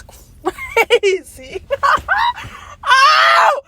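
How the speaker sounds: very loud, high, slow drawn crazy; screaming disbelief. Real, not acted. Ranting to viewers about the twist, shrieking. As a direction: scream it out in shock.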